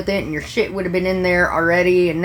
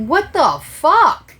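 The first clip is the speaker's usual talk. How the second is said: high, louder and slow, rising in disbelief; exasperated disbelief; 10 semitones higher, 6 dB louder, much slower than usual. Real, not acted. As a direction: stretch it out, fed up and incredulous. Server exasperated at stalling kid.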